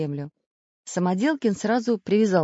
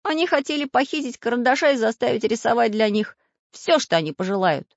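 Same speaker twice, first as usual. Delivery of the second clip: higher character voice; raised little storybook voice; Prutik's voice; 7 semitones higher, 5 dB louder than usual. A narrator's reading. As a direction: explain earnestly, pitched up.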